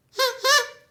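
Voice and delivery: big theatrical gasp, high and loud; loud storyteller gasp; dramatic gasp. Acted. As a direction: gasp in mock shock for the kids.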